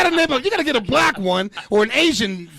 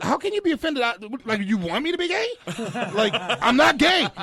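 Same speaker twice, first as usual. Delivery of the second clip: high-pitched, indignant retort; acted-out exasperated comeback; incredulous protest; 5 semitones higher than usual. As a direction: protest in disbelief, voice jumping up. Retells his indignant argument backstage.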